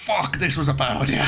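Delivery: frantic shout. Real, not acted. Surfacing under fire.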